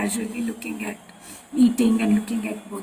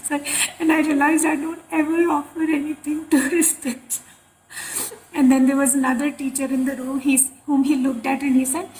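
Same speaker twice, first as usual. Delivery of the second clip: ordinary delivery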